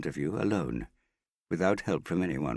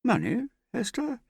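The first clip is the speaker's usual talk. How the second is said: high-pitched put-on astonishment; feigned surprise. A narrator's reading. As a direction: act surprised, pitch shooting up. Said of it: "he said, assuming surprise".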